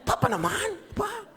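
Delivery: very high mock complaint; protesting parent. Parent hearing baby say mama first, high mock protest. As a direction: whine it up high, playfully put out. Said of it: "sabi niya".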